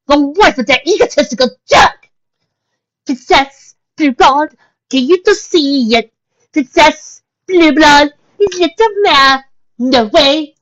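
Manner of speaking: high, refined pony mare voice; Rarity voice. Acted. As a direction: play the fussy unicorn lady. Rarity's voice in dialogue.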